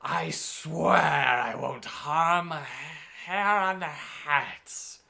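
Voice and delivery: very slow, creeping hag oath; sea hag promise. Acted. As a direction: drag each word out slyly. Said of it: "as she said".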